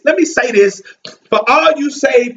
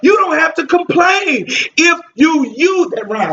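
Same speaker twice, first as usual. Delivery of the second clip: preaching at full force; 5 semitones higher than usual. Acted. Preacher to church, driving the refrain at full force. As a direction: hammer the refrain home to the congregation.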